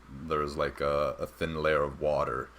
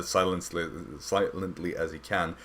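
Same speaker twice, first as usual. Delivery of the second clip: ordinary talk, nothing marked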